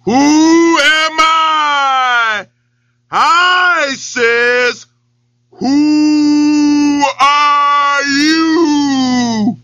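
long drawn-out echoing shout. Acted. The well echoes the lion's roar back. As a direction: stretch every word like a well echo. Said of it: "the voice came back".

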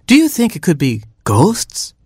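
high, wondering hen voice; Mama Hen. A narrator's reading. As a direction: ask it high, as a nervous hen. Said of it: "asked Mama Hen".

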